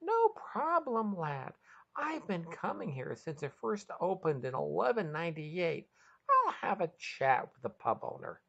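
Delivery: high, pleased voice with a grin; grinning. A narrator's reading. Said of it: "Max grinned wider than his ears".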